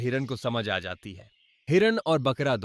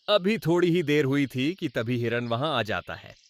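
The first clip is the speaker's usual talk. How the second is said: ordinary delivery, nothing marked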